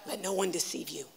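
low, soft and intimate; hushed warning. Acted. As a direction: drop it almost to a murmur. Repeats Jesus' warning twice.

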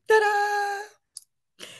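high, bright flourish; a showy little ta-da. Real, not acted. Wrapping up the branding talk, high ta-da flourish. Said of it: "closing the explanation".

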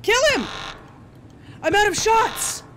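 high, loud panicked yell. Real, not acted. Player yelling at guards during the attack. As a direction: scream it at the guards.

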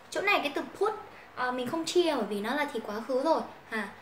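normal talking voice

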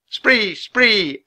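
high croaking raven cry. Acted. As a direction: squawk it like a raven. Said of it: "Annie croaked".

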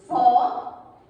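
"vó" punched loud and low on its own; sílaba tônica isolada. Shown as a demonstration. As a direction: hit "vó" hard as the strong syllable. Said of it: "ela possui uma sílaba mais forte". ("vó" isolado, bem forte)

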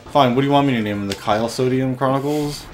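ordinary delivery

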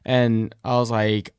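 usual speaking voice

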